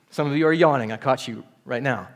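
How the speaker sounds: ordinary delivery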